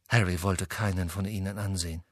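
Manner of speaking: plain reading voice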